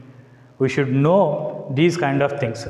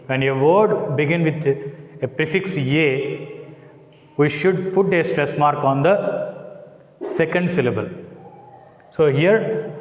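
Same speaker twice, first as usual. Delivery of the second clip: ordinary talk, nothing marked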